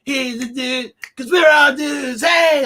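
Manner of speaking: high, loud goofy shouting dude. Retold or imitated. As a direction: belt it out like a dopey teen. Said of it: "step back into this role and be like".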